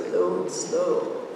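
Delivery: drawn-out, unhurried saying; slow. Shown as a demonstration. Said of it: "As we say in New Mexico".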